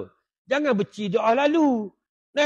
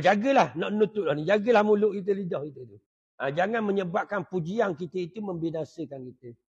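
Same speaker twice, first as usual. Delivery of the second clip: ordinary talk, nothing marked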